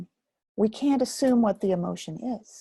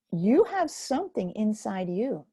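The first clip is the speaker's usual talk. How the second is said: usual speaking voice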